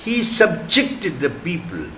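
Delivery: ordinary delivery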